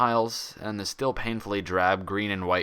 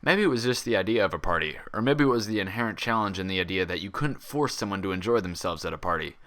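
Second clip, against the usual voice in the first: plain reading voice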